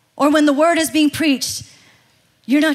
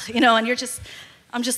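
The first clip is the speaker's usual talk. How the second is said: ordinary talk, nothing marked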